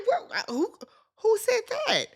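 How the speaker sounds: high, startled stammer of a caught man; mock-startled high squeak; flustered disbelief. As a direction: sputter like you've been accused. Dennis flustered denying accusation.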